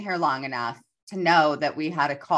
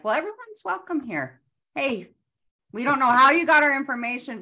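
mock polite welcome; 10 semitones higher than usual. Imitating their own polite reply.

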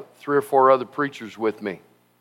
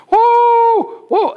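much higher, louder startled whoa; scared whoa; 5 dB louder than usual. Retold or imitated. Passengers as the plane lifts off, high startled cry. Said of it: "People go".

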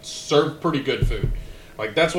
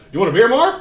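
Woody's greeting; 8 semitones higher, 6 dB louder than usual. Retold or imitated. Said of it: "Woody Harrelson goes".

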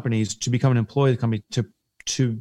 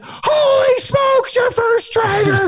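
much higher and louder, stretched slow with amazement; thrilled Southern rep; 5 dB louder, much slower than usual. Retold or imitated. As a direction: gasp it out like the best news of his day. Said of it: "he was so genuinely excited".

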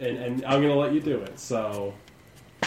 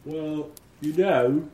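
slow half-orc; 4 semitones higher, 5 dB louder, much slower than usual. Acted. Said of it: "one of the half-orcs like".

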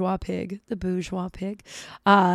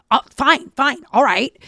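louder, higher imitation of huffy assent; visibly mad; 5 semitones higher, 8 dB louder than usual. Friend reacts to her boundary, mad. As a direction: mimic a man giving in angrily. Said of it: "got, like, visibly mad".

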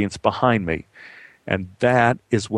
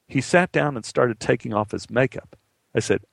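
usual speaking voice

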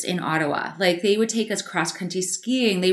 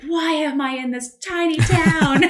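put-on teen exasperation; 7 semitones higher, 3 dB louder than usual. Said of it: "you're like".

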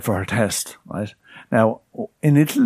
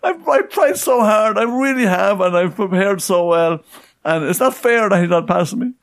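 high, begging imitation of the friend; pleading; 10 semitones higher than usual. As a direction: beg the instructor to do something. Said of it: "my friend pleaded and pleaded".

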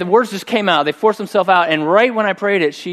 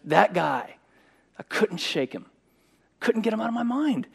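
hushed; 5 semitones higher, 7 dB quieter than usual. Real, not acted. Haunted memory, voice drops softer.

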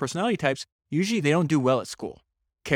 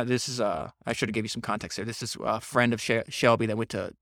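normal talking voice

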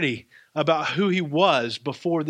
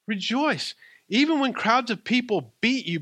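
high, firm Rejoice falling at the end; bold proclamation; 8 semitones higher than usual. Acted. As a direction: call out Rejoice to the congregation.

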